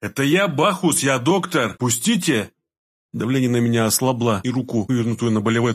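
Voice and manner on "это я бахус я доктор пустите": insistent protest with stretched words; urgent pleading. A narrator's reading. Doctor pinned by mistake identifies himself, urgent plea. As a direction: make them recognise you and let go.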